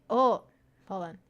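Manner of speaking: ordinary delivery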